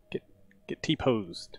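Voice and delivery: ordinary talk, nothing marked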